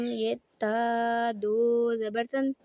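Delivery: ता दो ज़बर तन drawn out slowly, each part separate; slow spelling drill. Shown as a demonstration. Teacher spelling tanween slowly for students. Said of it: "इस तरह पढ़ना है हमने".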